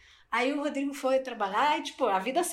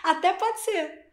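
unsure shrug; 8 semitones higher, slower than usual. Real, not acted. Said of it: "senti muita firmeza no até pode ser".

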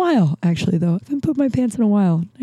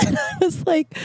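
laughing; 11 semitones higher, 5 dB quieter than usual. Real, not acted. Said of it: "I might start laughing really hard".

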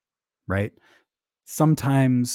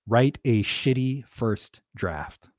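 slow quoted advice; 2 semitones higher, slower than usual. Retold or imitated. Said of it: "She says".

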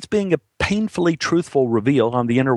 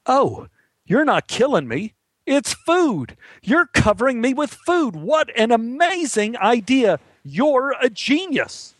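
high, amazed, delighted pooch voice; high-pitched astonished joy, stretched on the last words; dog's imagined voice; 12 semitones higher than usual. Acted. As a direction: voice an overjoyed dog, pitched way up. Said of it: "as if to say".

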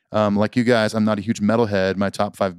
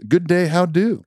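folksy greeting; 9 semitones higher, 4 dB louder, slower than usual. Pretend. Reading listener's quirky greeting.

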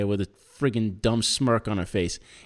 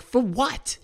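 high-pitched incredulous questioning; indignant; 7 semitones higher than usual. Retold or imitated. Defendant answering the official's farewell, high indignant retort. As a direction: throw it back in disbelief.

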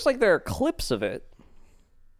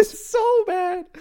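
exaggerated whine; slower than usual. Mock whine about bad movie.

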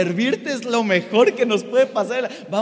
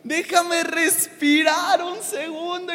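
slow, high, loud pleading to God; desperate plea; 7 semitones higher, 3 dB louder, much slower than usual. Retold or imitated. Preacher retelling his plea to God amid waves, slow loud begging. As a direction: beg for air.

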